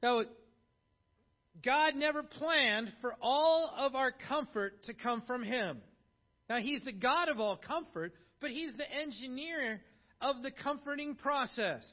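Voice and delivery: loud, high, ringing sermon delivery; full-force preaching. Acted. Pastor urging the congregation to comfort others, preaching loud. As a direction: preach it out to the whole church.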